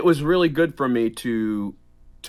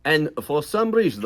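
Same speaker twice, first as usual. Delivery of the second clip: usual speaking voice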